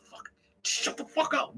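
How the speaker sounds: high, slow, breathy disbelief; imitating scandalised onlookers; mock shocked hush. Executives stunned by the teller's showcase answer, mock shocked hush. Acts meeting's shocked reaction.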